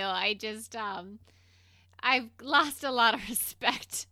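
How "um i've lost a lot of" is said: normal talking voice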